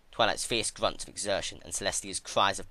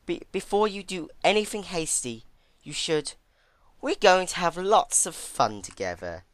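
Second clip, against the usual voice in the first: nervous stammer; 10 semitones higher, 5 dB louder than usual. A narrator's reading. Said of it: "she suddenly yelled very enthusiastically".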